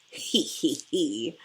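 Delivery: plain reading voice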